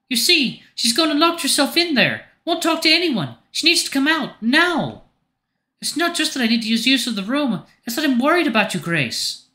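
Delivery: very high, worried and exasperated character voice; unhushed Marion. A narrator's reading. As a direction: complain openly in a high-pitched voice. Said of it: "makes no effort to lower her voice".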